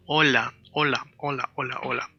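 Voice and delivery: hola with a light, soft l, tongue tip barely touching; soft Spanish L. Shown as a demonstration. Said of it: "A Spanish L is a soft L".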